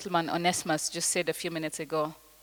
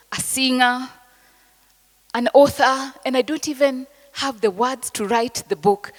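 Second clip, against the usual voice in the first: louder, higher-pitched rallying declaration; emphatic declaration; 4 semitones higher, 8 dB louder than usual. Acted. Speaker voicing dreamers' bold claims to an audience, emphatic declaration. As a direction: declare it out loud with force.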